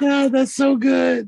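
slow, high, exaggerated pleasure; drawn-out teasing "so good"; mock savoring moan. Teasing gum chewing moan.

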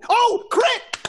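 high-pitched thrilled exclamation; excited outburst. Real, not acted. Player seeing a critical hit, high excited cry. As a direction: burst out high at the lucky roll.

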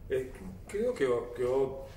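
ordinary talk

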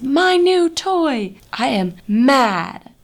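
high, loud, slow hammed-up outburst; angry Piggy. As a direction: stretch it out like a furious little pig. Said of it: "Piggy is expressing his anger".

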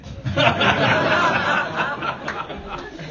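big high-pitched burst of laughter. Real, not acted. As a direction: laugh at your own failure. Laughing at himself.

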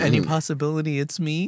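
mock hopeful. Shy quote from the anime.

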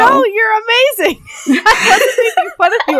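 high, giggly and breaking up; laughing reassurance. Real, not acted. Telling co-host she wasn't making fun, laughing. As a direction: reassure her through helpless laughter.

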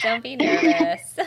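laughing reassurance. Real, not acted. Host reassures nervous guest before podcast, laughing. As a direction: laugh it out to put them at ease.

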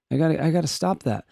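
ordinary talk, nothing marked

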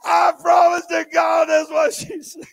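laughing. Real, not acted. Preacher amused, swears story.

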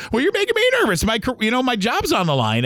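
retold high-pitched, agitated comeback; nervous protest. Fired employee answering his manager, nervous high protest. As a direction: throw it back, pitch leaping up then easing.

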